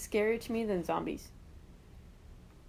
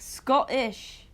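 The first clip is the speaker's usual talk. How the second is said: Scottish just tried out in a mock Scottish accent, a bit louder; Scottish as a quick attempt at a Scottish accent; 3 semitones higher, 4 dB louder than usual. Shown as a demonstration. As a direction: take a stab at saying Scottish in a Scottish accent. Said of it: "how do you do a Scottish accent?".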